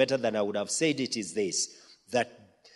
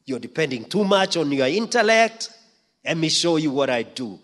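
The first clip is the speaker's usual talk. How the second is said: raised, louder rebuking voice; a higher, louder divine correction; voicing God's rebuke; 4 semitones higher, 7 dB louder than usual. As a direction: scold the preacher from on high. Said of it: "he's told".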